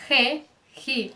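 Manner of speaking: "re, ri" begun with a throaty h; raspy Spanish G. Shown as a demonstration. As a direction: scrape the start of "re, ri" like Spanish j. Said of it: "vai ser pronunciado como".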